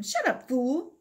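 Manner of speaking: snapping. Acted. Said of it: "Tino snapped at him".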